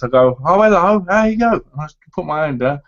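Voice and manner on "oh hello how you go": high, loud mock-cheery hello; over-friendly greeting. Husband greeting a man hitting on his wife, mock over-friendly. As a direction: put on an overdone, high-pitched welcome. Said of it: "or I go like this".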